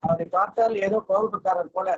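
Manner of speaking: ordinary delivery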